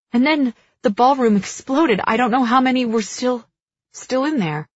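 distraught witness, stumbling over the words; shaken report. A narrator's reading. Shaken survivor reporting the explosion.

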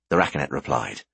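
plain reading voice

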